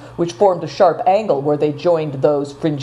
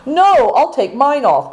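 higher, slower, insistent offer; eager cry; 9 semitones higher, 3 dB louder than usual. A narrator's reading. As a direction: jump in to volunteer. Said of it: "cried Affliction".

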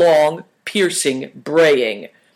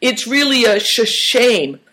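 high, stammered lament; bewailing; 8 semitones higher than usual. A narrator's reading. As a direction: stumble on the word while wailing. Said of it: "bewailed Uncle Chen".

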